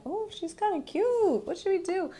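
high-pitched mock cooing; playful high little aside; cutesy inner voice. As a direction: coo it high, as if quoting yourself. Voices own reaction to packaging.

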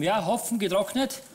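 usual speaking voice

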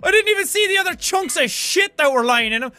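loud, fast, high-pitched indignant tirade; exasperated outburst at full tilt; out-of-character rant. Acted. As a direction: drop the accent and rant rapidly. Drops the doctor act, ranting.

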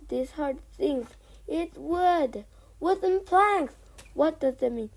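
small fox thrilled at the planks, calling out excitedly; excited discovery. Acted. Small fox to his father, excited and loud.

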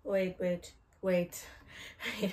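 ordinary talk

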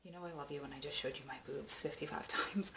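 soft whispered aside; whispering. Real, not acted. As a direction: whisper a cheeky afterthought. Closing aside after the bye.

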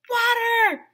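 high, loud cry of "water"; dramatic shout. Acted. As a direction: shout it as the big surprise. Dramatic climax word shouted.

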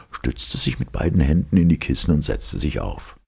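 plain reading voice

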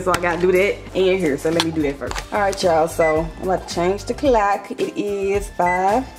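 ordinary delivery

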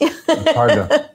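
words squeezed out through laughter; laughing. Real, not acted. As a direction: laugh while trying to speak. Giggling while tasting food and wine.

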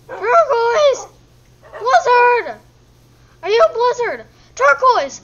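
loud, high calls for lost pets; calling out. Real, not acted. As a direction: holler the names.

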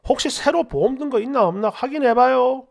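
animated reading of an online reply; comment read-aloud. Acted. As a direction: read the comment out briskly to listeners. Reads a viewer comment aloud.

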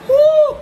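high, loud blown-out whoo; high loud whoo exhale. Retold or imitated. Letting off stress over the hole, high, loud whoo. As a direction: let the tension out with a sharp whoo. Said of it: "I was just like".

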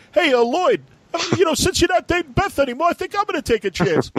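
very high, fast cartoon voice; squeaky Barney, rushed; Barney Rubble voice. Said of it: "you hear Barney Rubble go".

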